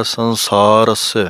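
ਸੰਸਾਰਸ said slowly as one joined word; slow joined demo. Shown as a demonstration. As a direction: blend ਸੰਸਾਰਸ into a single unit. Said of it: "ਇਕੱਠਾ ਬੋਲਣਾ ਹੈ".

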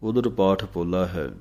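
normal talking voice

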